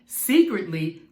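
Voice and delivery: usual speaking voice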